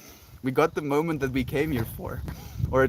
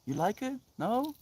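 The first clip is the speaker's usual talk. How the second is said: high, soft questioning voice; self-coaxing; 6 semitones higher, 5 dB quieter than usual. Retold or imitated. Talking to one's own tensed-up anger, gently coaxing. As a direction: coax gently upward, then let go on "release". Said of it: "And then I was like".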